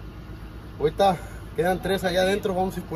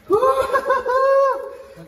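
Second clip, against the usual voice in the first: high burst of laughter; loud laugh; 3 dB louder than usual. Real, not acted. Big catch, laughing out loud.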